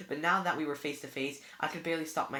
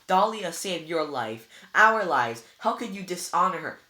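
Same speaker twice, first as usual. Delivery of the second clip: loud and higher, slowing on the first words; angry accusation; 5 semitones higher, 10 dB louder than usual. A narrator's reading. Annabeth accusing Luke of betrayal, loud and angry. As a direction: hurl the words at him. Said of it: "Annabeth sounded so angry".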